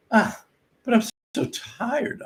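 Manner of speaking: high, whiny mock athlete sigh; mock a spoiled player moaning he's tired; a hammed-up tired college athlete whining.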